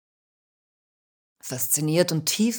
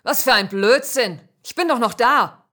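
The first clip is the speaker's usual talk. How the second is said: louder, higher furious retort; shocked angry snap; 6 semitones higher, 7 dB louder than usual. Acted. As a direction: snap back in outraged disbelief. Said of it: "Schockiert und wütend schnauzte mich an".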